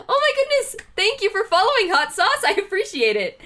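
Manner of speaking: high, loud, delighted gush; thrilled squeal of gratitude; excited thanks. Real, not acted. Streamer thanking a new follower in an excited high voice.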